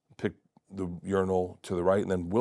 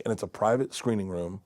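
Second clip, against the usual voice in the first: ordinary delivery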